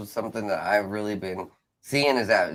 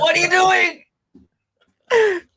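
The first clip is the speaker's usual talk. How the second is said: imitating alarmed parents, very high; high-pitched mock panic; shocked parents; 5 dB louder, slower than usual. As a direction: squeal it like a horrified parent. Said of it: "my parents".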